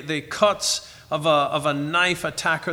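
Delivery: ordinary talk, nothing marked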